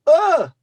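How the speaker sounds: high, loud shriek of fright; frightened scream. Shown as a demonstration. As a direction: demonstrate a sudden startled yell. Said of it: "I don't frighten often like that".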